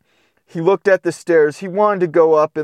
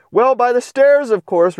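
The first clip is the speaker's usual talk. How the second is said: high, louder matter-of-fact reply; bright puppy answer; 7 semitones higher, 4 dB louder than usual. Acted. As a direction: tell him the obvious happily.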